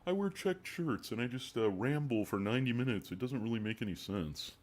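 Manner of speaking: mock a rambling data guy; nerdy analyst impression. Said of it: "It's like".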